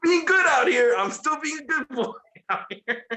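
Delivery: high, playful mock voice with laughter; imitating CM Punk to his wife. CM Punk greeting his wife on air, high mock playful voice. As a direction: ham up a sweet message to his wife. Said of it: "he says hello to his beautiful wife".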